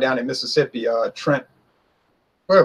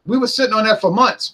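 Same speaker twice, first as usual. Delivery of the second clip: higher mocking voice of the accusers; mocking quote; 7 semitones higher, 3 dB louder than usual. Pundit mocking accusers in a higher voice. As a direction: sneer out their excuse in a lifted voice. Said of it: "And say".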